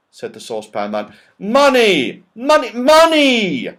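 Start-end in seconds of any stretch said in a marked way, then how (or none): 1.4-3.7 high, loud, slowing insistent shout. Acted. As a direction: drill the word home to a deaf friend. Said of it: "shouted Moonface".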